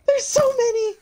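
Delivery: very high crying shriek; shrieking panic. Real, not acted. Overwhelmed by vexes.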